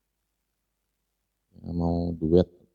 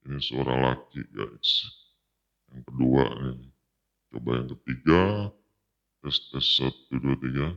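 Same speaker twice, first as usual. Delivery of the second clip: much lower male filter voice; deep voice changer on the mic; 5 semitones lower than usual. Shown as a demonstration. (suara cowok voice changer)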